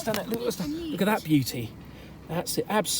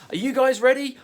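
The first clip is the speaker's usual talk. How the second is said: excited; 7 semitones higher, 7 dB louder than usual. Real, not acted. Hyping kids before going out.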